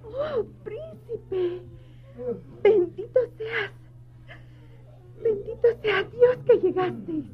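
tearful, slow, voice breaking; crying with joy. Acted. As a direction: weep the words out in relief. Maid weeps at prince's arrival.

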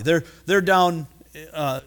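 usual speaking voice

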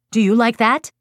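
loud, high, angry challenge; frustrated parent. Retold or imitated. Frustrated parent to son after poking him, loud angry challenge. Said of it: "the intensity of my frustration".

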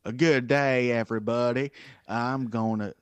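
loud hammed-up Australian accent. Asked by cohost to read as an Australian, loud mock Australian accent. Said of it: "put on your best Australian accent".

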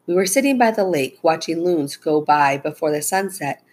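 ordinary delivery, nothing marked

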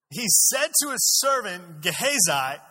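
loud, high, slow verse reading; forceful scripture reading. A narrator's reading. Preacher reading about the man of God's servant, loud proclamation. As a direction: proclaim it slowly to the room.

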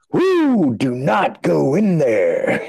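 high, slow mock warning call; calling out a silly warning. Retelling the prank, voicing the warning guy.

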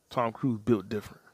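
ordinary talk

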